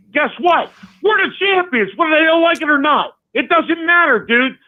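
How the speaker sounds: rally the fans like it's a victory speech; fired-up boast. Real, not acted. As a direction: pitch climbing high into a shout. Caller's champions rant building up.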